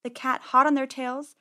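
ordinary delivery, nothing marked

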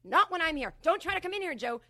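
loud, high threatening shout; pretend shout. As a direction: yell him off the front steps. Imagined confrontation, threatening Joe.

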